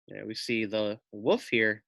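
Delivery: ordinary delivery, nothing marked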